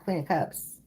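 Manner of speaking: normal talking voice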